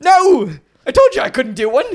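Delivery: loud, high botched Irish brogue; terrible Irish accent. Acted. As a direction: argue back in a failed Irish accent. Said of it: "does not sound Irish in the slightest".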